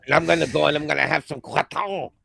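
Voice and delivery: mock Quebec politician, hammy French-Canadian; bad Quebec accent. Mocking a Quebec premier in a bad Quebec accent. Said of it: "I'm doing a really bad impression of Jean".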